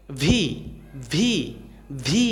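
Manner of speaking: English "vee" for ভি; lip-to-teeth V. Shown as a demonstration. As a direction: buzz the v, then release into a long ভি. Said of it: "উপরে দাঁতের সাথে নিচের ঠোঁটটা".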